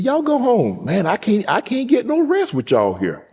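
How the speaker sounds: high granny voice, fed up with visitors; fussy grandmother. Retold or imitated. Grandmother shooing family home.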